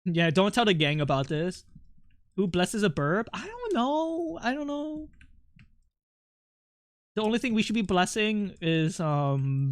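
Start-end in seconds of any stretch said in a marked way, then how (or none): 3.3-5.1 high, soft, drawn-out shrug; playful cluelessness. Real, not acted. Streamer dodges who blesses a burp, high playful cluelessness. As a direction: trail it out high and small.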